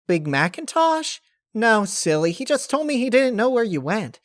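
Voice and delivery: squeaky excited cartoon pony; Pinkie Pie. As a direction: chirp it out like an eager, bouncy pony. Pinkie answers cheerfully.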